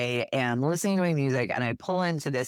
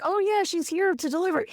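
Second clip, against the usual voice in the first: much higher, lightly voiced helpful lady; mock helpful neighbor. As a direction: toss off an imagined neighbor's chirpy line. Imagined neighbor lady speaking up.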